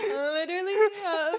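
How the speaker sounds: slow words through laughter; laugh-crying the words. Real, not acted. Laughing through mock insult.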